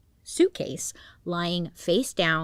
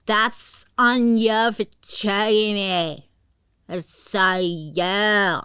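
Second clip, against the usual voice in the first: very slow, slurred, louder taunt; drunk slurring; 3 semitones higher, 5 dB louder, much slower than usual. Retold or imitated. Drunk girlfriend slurring taunts at the trapped man. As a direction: drag the words like you're sloshed. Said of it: "her words are all slurred".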